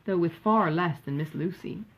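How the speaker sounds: usual speaking voice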